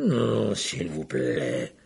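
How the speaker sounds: moaning plea. Acted. Sirius begs the dementors, slow moaning plea. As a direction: beg slowly, voice sinking. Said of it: "gémissait-il".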